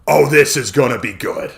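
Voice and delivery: loud gleeful grin; grinning. Acted. Connor grinning at Rebecca's clever plan. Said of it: "Connor grinned".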